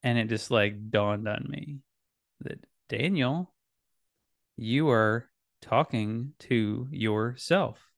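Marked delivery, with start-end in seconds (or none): 2.9-7.9 slow, pointed self-reproof; deliberate self-address. Retold or imitated. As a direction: spell out a dawning realization to yourself, word by word. Acted realization, addressing himself.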